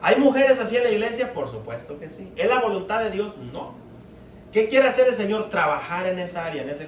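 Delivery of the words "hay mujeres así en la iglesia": preaching call. Real, not acted. Preacher calling out to congregation.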